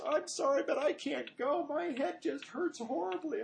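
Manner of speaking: high, teary daughter's voice; daughter crying. Retold or imitated. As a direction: voice the upset little girl pleading.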